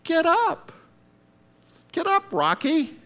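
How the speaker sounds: high, strong calling voice; God rousing him. Retold or imitated. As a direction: shake him out of his pity party. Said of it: "And God says".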